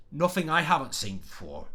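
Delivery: put-on Cockney voice, th slurred in "Nothing"; Cockney accent. Shown as a demonstration. Writing teacher shows viewers a character's dialect, Cockney accent. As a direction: read the line as a Cockney would. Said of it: "like, a Cockney accent, he'd say".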